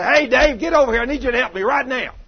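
high, hollered summons; screaming for help. Acted. Pastor calling Dave over in the shop, urgent acted yell. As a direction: holler across the shop for a helper. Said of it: "screaming at you".

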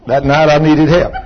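usual speaking voice

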